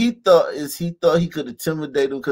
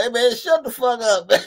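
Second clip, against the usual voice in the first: imagined telling-off; 8 semitones higher, 3 dB louder than usual. Retold or imitated. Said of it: "I was like".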